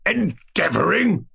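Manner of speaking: slow, high, indignant cry; fierce. A narrator's reading. Tyrant challenging the dwarf's excuse, fierce. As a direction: throw the word back fiercely, drawn out. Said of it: "cried the tyrant fiercely".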